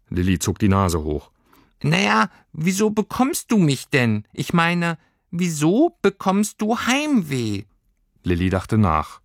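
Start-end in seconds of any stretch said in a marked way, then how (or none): 1.8-7.6 high, slowing creature voice; little creature reasoning aloud; high fussy creature asking why; puzzled creature. Acted. Heimweh voice.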